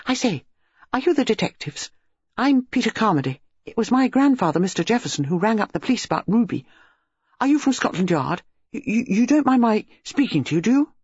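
high, eager, tumbling schoolboy voice; a nine-year-old bursting with questions; excited boy. A narrator's reading. Boy pestering the detectives, eager and breathless. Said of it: "He burst immediately into excited speech".

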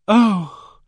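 a high, loud sobbed "Oh!" of relief; a loud, high relieved sob. Acted. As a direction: let the relief break out as a sob. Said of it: "It was a sob of relief".

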